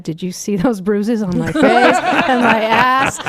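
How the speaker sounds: cracking up while talking, pitched high; real laughter breaking into the words; laughing. Real, not acted. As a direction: laugh your way through an embarrassing confession. Cracking up at her own confession.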